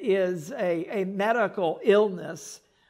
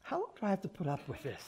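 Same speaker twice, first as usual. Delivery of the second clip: weary frustration; 11 dB quieter, much faster than usual. Retold or imitated. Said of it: "listen to how he's talking to these people".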